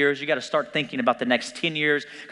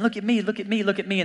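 put-on higher pleading kid voice, repeated; imitating a small child; 7 semitones higher than usual. Small kids calling to busy parents, higher pleading voice. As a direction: beg for attention like a little kid.

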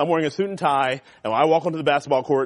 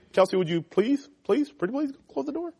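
mock pleading; 10 semitones higher than usual. Pastor pleads with a student.

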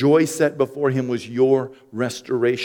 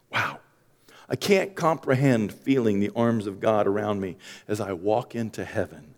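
ordinary talk

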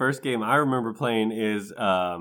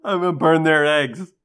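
gleeful; 6 semitones higher, 7 dB louder than usual. Real, not acted. Choosing joke answer excitedly.

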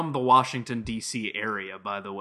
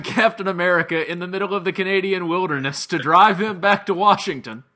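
usual speaking voice

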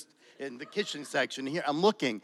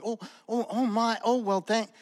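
higher, breathless little exclamations of disbelief; shocked gasps; 8 semitones higher than usual. Retold or imitated. Homeless woman handed twenty dollars, stunned and fumbling. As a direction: imitate a stunned stranger lost for words, pitched up.

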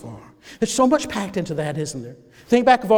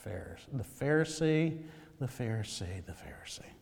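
each repeat softer until breathless; letting the word die away into breath; fading to whisper; 8 semitones lower, 14 dB quieter than usual. Real, not acted.

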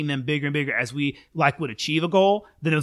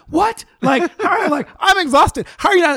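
high, loud incredulous squeal of disbelief; stunned disbelief; 6 dB louder than usual. Retold or imitated. Telling a new teacher she's exhausted, incredulous high squeal. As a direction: gasp it out, amazed she isn't tired. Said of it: "And I'm like".

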